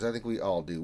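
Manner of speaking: usual speaking voice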